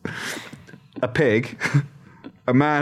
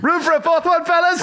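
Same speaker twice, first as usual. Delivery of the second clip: calling out. Pretend. Calling out as the rimmer.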